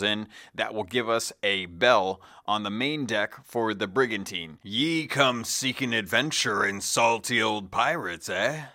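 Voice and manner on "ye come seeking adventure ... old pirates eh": lightly voiced salty old pirate; gruff old fisherman. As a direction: growl it like an old sea dog. Quoted in-game pirate speech.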